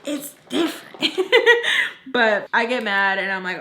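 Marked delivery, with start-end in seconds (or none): 0.1-1.0 high-pitched sassy thought; mock-indignant inner voice; retold inner protest. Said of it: "in my head, I'm like". 1.0-2.0 high, loud burst of laughter; real laugh. Real, not acted. Laughing at her own line.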